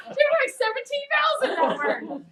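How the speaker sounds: high, loud, still laughing; can barely get it out for laughing; amused teacher laughing. Real, not acted. Laughing over child's vowel.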